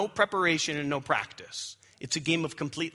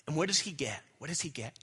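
hushed suspense; 7 dB quieter than usual. Acted. Building suspense to reveal.

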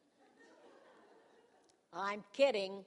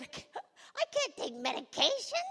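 high, incredulous squawk; mock horrified protest; 13 semitones higher, 3 dB louder than usual. Patient refusing the doctor's prescription, mock horrified protest. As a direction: balk at it in exaggerated shock.

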